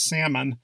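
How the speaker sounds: salmon said SAM-un; silent L. Shown as a demonstration. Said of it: "the correct pronunciation is".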